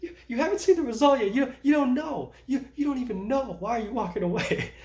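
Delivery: loud, high, laughing mock outrage; half-laughing, act out yelling at someone for walking off; annoyed outburst. Bank worker calling after a lady walking off, laughing mock-annoyed shout. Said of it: "And I got super annoyed".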